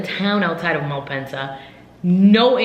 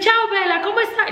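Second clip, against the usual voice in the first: much higher, airy mock Italian charm; breezy driver; 14 semitones higher, faster than usual. The late taxi driver greeting her, a hint of breezy charm. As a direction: toss it off like a carefree charmer.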